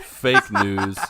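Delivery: laughing. Real, not acted. Laughing at joke.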